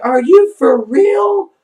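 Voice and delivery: high, loud and slow disbelief; shocked disbelief. Retold or imitated. Told the thing is really there, she answers in slow shocked disbelief. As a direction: stretch it out in shock.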